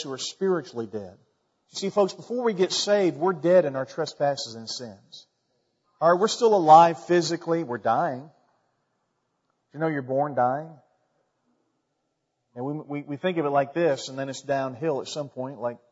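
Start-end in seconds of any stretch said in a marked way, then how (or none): none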